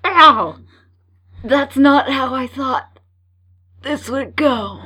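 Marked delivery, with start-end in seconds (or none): 0.1-0.6 pained yelp. Acted. Seagull attack, cries out.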